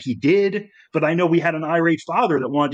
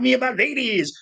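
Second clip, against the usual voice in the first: higher, lingering [name] line; a lightly higher [name] echo; [name] impression; 7 semitones higher than usual. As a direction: savour the words like the Godfather of Soul. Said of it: "It's like".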